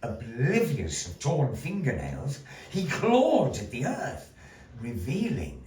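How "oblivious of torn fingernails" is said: plain reading voice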